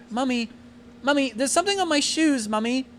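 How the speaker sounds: put-on whiny little girl tugging at her mother. Little girl voiced in story.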